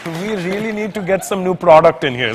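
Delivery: put-on slow, drawn-out Indian-accented voice. Comic's Indian side judging the camcorder, slow Indian accent. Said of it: "my Indian side kicks in".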